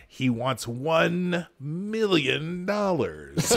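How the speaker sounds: very slow, high villain demand; mock supervillain. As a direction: stretch each word like a hammy movie villain. Bond villain joke, supervillain demand.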